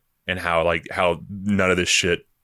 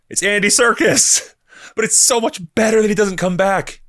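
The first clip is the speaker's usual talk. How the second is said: higher and louder, laughing gush; gushing excitement; 13 semitones higher, 4 dB louder than usual. Real, not acted. Fan raving about Andy Serkis, excited gush. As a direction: squeal it out, delighted.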